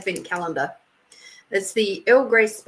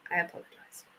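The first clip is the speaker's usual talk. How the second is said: whispered apology; 4 semitones lower, 13 dB quieter, faster than usual. Pretend. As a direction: whisper the sorry, much softer. Repeats apology, then whispers it.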